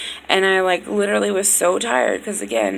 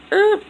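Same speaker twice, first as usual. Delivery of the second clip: embarrassed yelp; 13 semitones higher, 7 dB louder than usual. Real, not acted. Caught saying a name.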